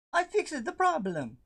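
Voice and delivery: squeaky proud. Pretend. Proud of fixing it.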